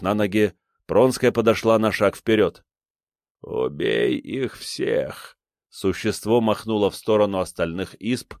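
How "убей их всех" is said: low slow creature command. A narrator's reading. Creature orders the killing.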